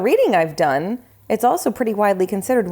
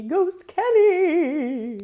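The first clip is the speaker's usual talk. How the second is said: ghost voice; 8 semitones higher, much slower than usual. Pretend. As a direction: float the words out like a ghost. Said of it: "that was just a voice I was doing".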